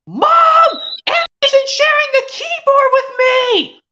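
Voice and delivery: put-on very high, loud tattling child; whiny kid shout. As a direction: yell for mom like a wronged kid. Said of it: "see if I can do another impression".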